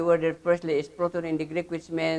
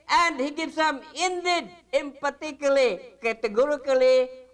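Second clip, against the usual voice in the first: "indeed, emphatically, categorically" each pitched high and punched; emphatic insistence; 6 semitones higher than usual. Shown as a demonstration. Teacher repeating the expanded verse to the class, emphatic.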